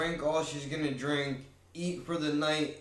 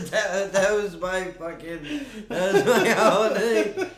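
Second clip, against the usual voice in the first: louder, laughing through words; 6 semitones higher, 10 dB louder than usual. Real, not acted. Laughing at own tale.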